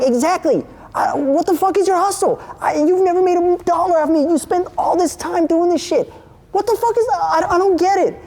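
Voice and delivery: very high, incredulous rant; retold exasperated outburst. Storyteller demanding the dude explain his hustle, high and exasperated. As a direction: let exasperation send the pitch way up.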